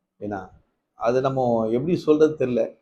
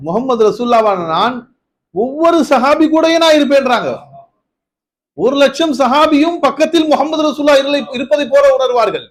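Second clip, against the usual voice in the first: much higher, louder impassioned declaration; fervent preaching; 10 semitones higher, 6 dB louder than usual. Acted. Preacher to congregation proclaiming the Prophet's presence, impassioned and loud. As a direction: build to a fervent climax.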